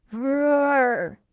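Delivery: a lightly voiced dinosaur roar. A narrator's reading. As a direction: roar like a playful T. rex. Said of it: "says the dinosaur".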